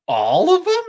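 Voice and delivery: squealing all of them in astonished delight; shocked excited disbelief. Retold or imitated. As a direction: mimic an over-the-top game-show gasp of disbelief. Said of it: "You know how he's like".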